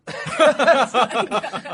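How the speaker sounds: high burst of real laughter; laughing. Real, not acted. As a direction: laugh at your own joke. Laughing at own joke.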